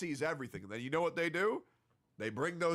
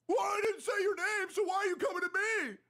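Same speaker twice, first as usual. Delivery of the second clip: indignant shout; 14 semitones higher, faster than usual. Real, not acted. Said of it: "angry retort".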